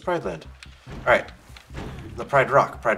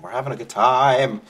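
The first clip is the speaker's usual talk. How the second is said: louder forced cheer; 9 dB louder than usual. Real, not acted. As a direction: announce it loudly and cheerfully. Loud repeat.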